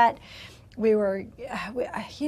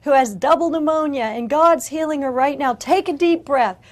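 louder, higher and fast, fervent announcing; excited ministers; 4 semitones higher, 8 dB louder than usual. Retold or imitated. Ministers announcing healing to the room, excited and loud. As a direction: proclaim the healing with rising fervour. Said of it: "and they said".

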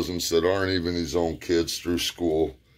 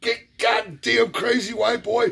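high stammered mock old-man voice; stuttering uncle. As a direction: sputter it out half annoyed, half amused. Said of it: "And he goes".

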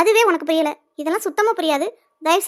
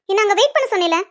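usual speaking voice

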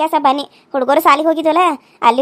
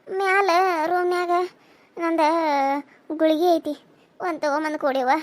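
weak and ailing; 5 dB quieter than usual. Acted. Mother-in-law pleading weakly with the guest for water. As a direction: ask for water as if parched and sick.

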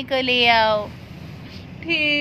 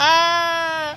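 mock panic; 2 semitones higher, 3 dB louder, much slower than usual. Duck begs the cat to let go.

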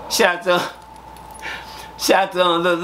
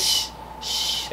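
soft breathy shush, shh shh; 7 dB quieter than usual. Retold or imitated. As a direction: hush him quietly in the line. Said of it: "I said".

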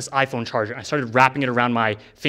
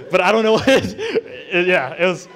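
real giggles, higher and louder; laughing through the words; 6 semitones higher, 3 dB louder than usual. Real, not acted. Telling viewers about the weird trip, laughing.

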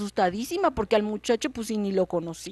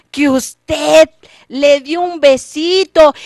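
louder, slow, stretched out; triumphant reveal; 5 semitones higher, 11 dB louder, much slower than usual. Acted. The boy reveals the king's secret before everyone, loud and slow. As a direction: savor the winning line. Boy's trump card.

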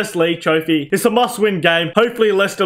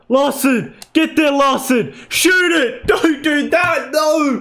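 much higher, yelling; frantic shouting; 7 semitones higher, much slower than usual. Real, not acted. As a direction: shout at him to shoot. Last chance, frantic.

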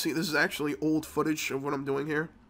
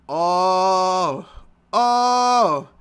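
high, loud startled oh; stunned oh; 4 semitones higher, 9 dB louder than usual. Retold or imitated. Worker reacting to the architect's flat refusal, startled. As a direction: gasp it out, caught off guard.

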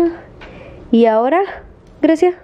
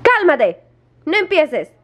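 high, falling warning to a pet; scolding; 6 semitones higher, slower than usual. Real, not acted. Owner warns dog not to start, scolding.

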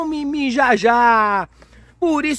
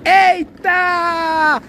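excited shouting; 5 semitones higher, much slower than usual. Real, not acted. Dad hyping the toy truck for his girls, high excited shouts. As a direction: whoop it up like the game is thrilling.

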